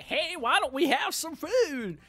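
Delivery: high, slow mock character voice; paraphrasing the character. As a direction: put on a sing-song character voice. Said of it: "She's like".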